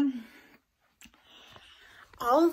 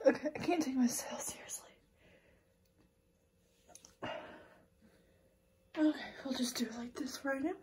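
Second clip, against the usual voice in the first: whispering; 7 dB quieter than usual. Real, not acted. Laughing at herself while filming.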